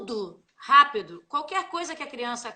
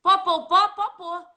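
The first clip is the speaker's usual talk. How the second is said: loud higher mock Minas pó, pó, pó; Minas speech; 5 semitones higher, 8 dB louder, slower than usual. Teacher mocks fast Minas speech, loud and playful. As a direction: rattle off pó like a mineiro. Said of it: "Minas, então, você fala assim".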